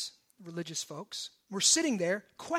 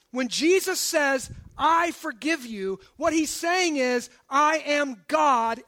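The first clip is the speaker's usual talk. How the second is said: emphatic, raised preaching voice; impassioned preaching; 4 semitones higher, 5 dB louder than usual. Acted. Preacher urging sinners that Christ wants them, impassioned. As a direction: drive each phrase home to the congregation. Preaches with full force, voicing God.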